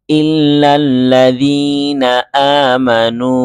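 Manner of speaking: slow, drawn-out chanted reading; Quranic recitation. Shown as a demonstration. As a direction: recite the words slowly and melodiously. Quranic recitation of the assembled words. (ধীর সুরেলা কুরআন তিলাওয়াত)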